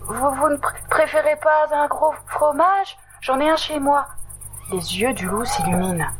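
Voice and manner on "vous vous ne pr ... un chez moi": higher, halting suggestion; nervous offer. Acted. Totoche nervously offering the wolf cheese, higher. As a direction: tempt the wolf while scared. Scared mouse bargains.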